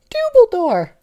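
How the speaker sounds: very high, loud battle cry; crying Tinky Winky. Acted. Tinky Winky yelling at Dumbledore, very high. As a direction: shriek the name. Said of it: "cried Tinky Wink".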